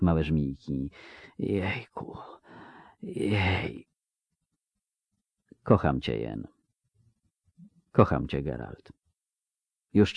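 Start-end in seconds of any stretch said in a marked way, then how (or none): 1.4-3.9 very soft, drawn-out sighs of pain and pleasure; breathy sigh. Acted. Westchnienia przy cięciu żył. 5.6-6.5 much softer and lower, fading to nothing; tender farewell. Acted. Ostatnie pożegnanie kochanków. 7.9-8.9 much softer and lower, fading to nothing; tender farewell. Acted. Ostatnie pożegnanie kochanków.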